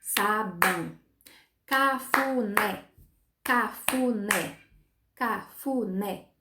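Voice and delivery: slow, evenly beaten syllables with gaps; "Sabão" as sa-bão, "Cafuné" as ca-fu-né, one beat each; clapped-out syllables. Shown as a demonstration. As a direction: chop each word slowly to the beat of claps.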